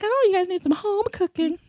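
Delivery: put-on high-pitched doting old-lady voice; hammed-up old neighbor offering food; elderly neighbor. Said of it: "neighbors are all elderly people".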